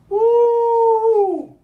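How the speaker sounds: loud, high whoop of delight; excited whoop. Real, not acted. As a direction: let out a big joyful woo. Reacts to tasting spicy sauce.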